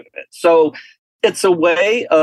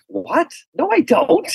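incredulous denial; 13 semitones higher, slower than usual. Retold or imitated. Denying being a devil worshiper.

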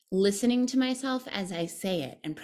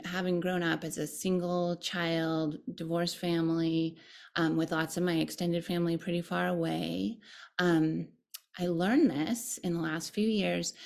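ordinary talk, nothing marked

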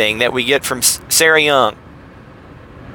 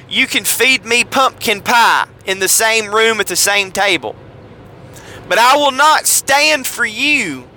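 high-pitched Southern Baptist lady teacher; Beth Moore impression; 5 semitones higher than usual. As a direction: put on a higher Texas church-lady voice, scolding. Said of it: "a somewhat better impression of Beth Moore".

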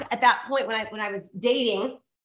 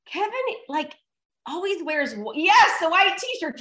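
put-on much higher, childlike voice; little daughter's voice; 5 semitones higher than usual. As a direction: imitate a little girl talking to mom. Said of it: "she's like".

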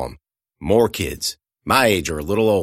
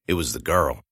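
usual speaking voice

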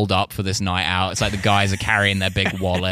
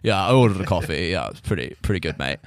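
smug foreigner; 4 semitones lower than usual. Retold or imitated. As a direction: mumble it like a cocky expat. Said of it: "they're like".

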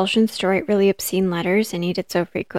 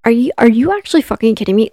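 loud, fast, higher-pitched disbelief; incredulous outrage; 4 semitones higher, 7 dB louder, faster than usual. Real, not acted. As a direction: burst out, appalled at the absurd claim. Outrage at the defense's claim.

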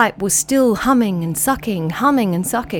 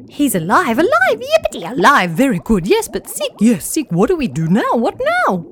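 jumpy high squeaky chatter; giddy high fish voices; excited fish chatter; 3 semitones higher than usual. Acted. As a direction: bubble over with relief.

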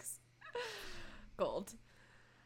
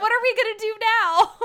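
dismayed Jocelyn quote; 11 semitones higher, 8 dB louder than usual. Retold or imitated.

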